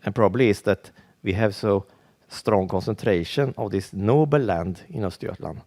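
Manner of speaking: ordinary talk, nothing marked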